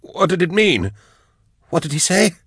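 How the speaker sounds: high, strained panic; desperate high-pitched questioning; frantic. A narrator's reading. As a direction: plead for an answer, pitch way up. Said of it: "he repeated frantically".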